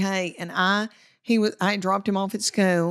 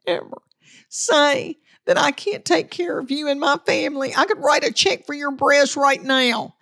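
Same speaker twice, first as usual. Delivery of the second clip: mad husband; 6 semitones higher than usual. Retold or imitated. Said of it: "he got so mad and said".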